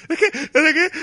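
mock sob, very high and loud, hiccupping; sobbing with hiccups. Imitating the girl's sobbing, hiccupping cry. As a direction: catch the breath like a crying child. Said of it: "como especie como de pequeño hipo".

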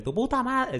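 hammed-up pitchy insult; mock insult yell. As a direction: fling it like a chatroom troll, half playing. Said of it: "Para decirle".

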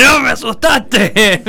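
startled yell. Retold or imitated. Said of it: "le dije".

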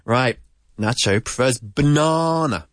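usual speaking voice

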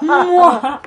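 high, loud villain cackle; evil laugh. Shown as a demonstration. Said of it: "una risa maligna".

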